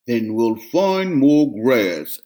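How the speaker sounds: slow, deep steady goat voice; big brother goat, unbothered; calm big goat. Acted. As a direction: reassure the little ones.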